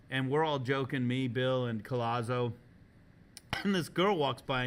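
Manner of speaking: ordinary talk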